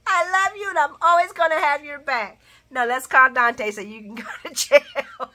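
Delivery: mock tenderness breaking into laughter; laughing retelling. Vlogger redoing Laura's line, laughing. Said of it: "That's exactly how it went".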